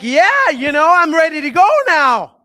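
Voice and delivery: loud, pumped-up mock cheer; a loud, recharged "ready to go"; energized. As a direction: sound like you just did jumping jacks and feel great. Imagined energized listener after jumping jacks.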